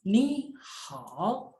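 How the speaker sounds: ni hao with ni rising and hao dipping; second then third tone. Shown as a demonstration. Teacher repeats the changed reading, ni hao with rising ni. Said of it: "tapi dibaca ni hao".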